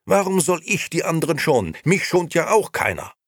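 loud, high, heated tirade; vehement. A narrator's reading. Nino complains to his wife about rising costs, vehement. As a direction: argue heatedly, rising in pitch. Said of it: "antwortete Nino heftig".

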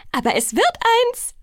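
high, loud singsong; trilled. A narrator's reading. Alice is sure she has won, trilling. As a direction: sing it out gleefully. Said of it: "trällerte sie".

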